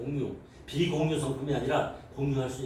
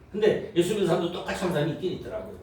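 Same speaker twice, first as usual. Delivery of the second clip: normal talking voice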